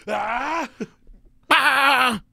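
high, loud battle yell; war cry. Retold or imitated. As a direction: scream like a soldier under attack. Grenade attack in imagined war scene.

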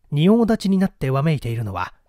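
plain reading voice

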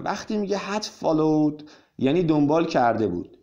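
ordinary talk

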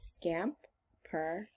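scampering drawn out slowly, syllable by syllable; slow stretched word. Shown as a demonstration. Teacher stretching a word so kids count syllables, slow. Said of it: "How many syllables".

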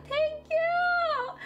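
high, emotional thanks; choked up, gushing thanks; tearful thank you. Real, not acted. Overwhelmed by super chat.

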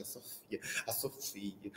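a hushed gossipy whisper; whispered aside. Real, not acted. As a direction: whisper her name conspiratorially. Whispered aside about annoying kid. (cochichando o nome da aluna chata para a plateia)

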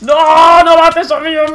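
very high, drawn-out loud wail; desperate scream. Real, not acted. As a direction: scream in despair for your friend. Friend being killed in game.

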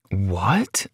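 put-on stunned boyfriend mumbling at the blue eyes; mumbled. A narrator's reading. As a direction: mumble it under your breath. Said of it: "I mumbled".

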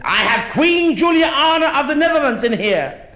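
high, loud proud announcement; pompous Dutch driver. Retold or imitated. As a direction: puff up and declare the VIP. Dutch driver announcing his VIP.

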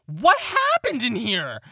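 high, drawn-out shout; loud exclamation. Acted. Kurt opens the door on the room, loud high exclamation. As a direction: cry out loudly, stretching the words. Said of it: "hear Kurt open the door and go".

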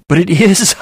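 high loud cry; sharp high exclamation of distress; cried out. A narrator's reading. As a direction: burst out desperately. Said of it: "I cried".

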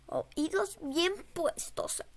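slow hushed whisper. Shown as a demonstration. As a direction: breathe it out slowly.

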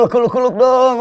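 fan shout. Retold or imitated. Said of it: "teriak orang".

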